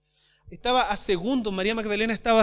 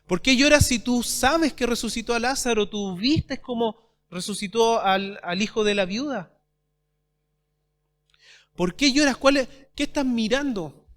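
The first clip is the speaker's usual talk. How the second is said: loud, higher-pitched preaching; emphatic challenge; 4 semitones higher, 6 dB louder than usual. Acted. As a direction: press the mourner with forceful questions. Preacher voices heaven questioning the mourner.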